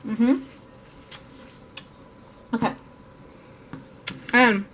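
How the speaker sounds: talking with a mouth full of food. Real, not acted. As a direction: speak around a bite of ice cream. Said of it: "talk with my mouth full".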